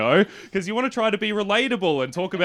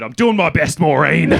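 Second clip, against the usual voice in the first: loud, aggrieved mock retort; imitated exasperated husband; 8 dB louder than usual. Husband answering wife Maureen, loud exasperated retort. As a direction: protest loudly as a put-upon husband.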